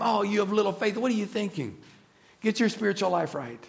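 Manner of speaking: a hint of a disappointed teacher scolding; scolding Jesus. Preacher voices a punishing Jesus to the church, mildly scolding. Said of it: "I don't believe that Jesus is punishing them".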